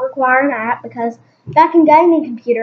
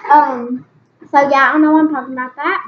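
usual speaking voice

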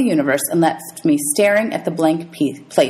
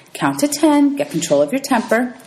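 counselor advice; 6 semitones higher than usual. Retold or imitated. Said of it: "counselor had told me".

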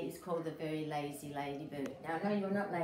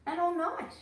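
sudden high rise on night for fun; playful high rise; 11 semitones higher, 4 dB louder than usual. A narrator's reading. Reading to a child, a high playful rise. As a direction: lift the voice right up as a tease. Story, building the joke.

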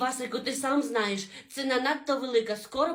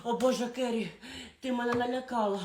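ordinary talk

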